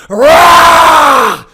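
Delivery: huge raw roar, high and loud; roaring out the stuckness; lion roar. Shown as a demonstration. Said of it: "literally release this".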